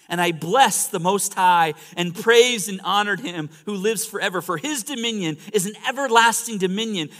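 loud, high declamatory scripture reading; full-force preaching. Acted. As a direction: proclaim the verse to a whole congregation.

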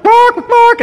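high yappy mock bark; playful high bark imitation; dog barking. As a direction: yap it like a small dog. Imitating dogs in the story.

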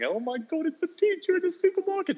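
high-pitched mock-terrified child; cowering. Kids spotting their teacher outside school, mock cowering. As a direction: squeal it like a petrified kid in mimicry. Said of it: "they're cowering".

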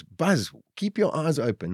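high, mild mock call, no real volume; retold shout. Calling to Buzz on night duty, mild retold shout. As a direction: imitate calling up to a dozing crewmate. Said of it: "I'd shout at us".

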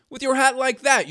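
storybook leader's indignant telling-off; scolding. A narrator's reading. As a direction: chide him from on high. Leader scolds man's hat.

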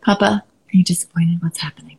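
put-on anxious child's whisper; whispered child worry. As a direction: breathe it out like a worried little kid. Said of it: "They're the ones that go".

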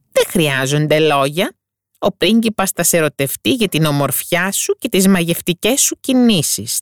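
a witch luring her into the deal; sea witch persuading. Acted. Witch reassures the mermaid about the prince, coaxing.

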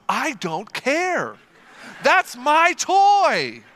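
put-on slow, loud, high defiant child; stubborn daughter. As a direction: drag it out like a stubborn kid. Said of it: "Her response was".

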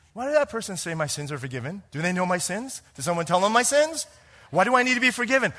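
bewildered, rising and speeding up; puzzled. Pretend. A stranger puzzles over the preacher's words, growing bewildered. Puzzled listener wonders that night.

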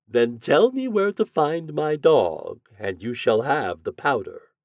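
theatrical storybook wizard's bargain. A narrator's reading. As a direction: promise grandly, stretching the first words.

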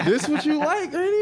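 high squeaky laughing taunt; laughing high teasing. Retold or imitated. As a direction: tease granny through laughter, high up.

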